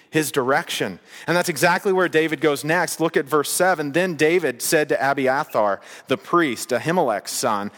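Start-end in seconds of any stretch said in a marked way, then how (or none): none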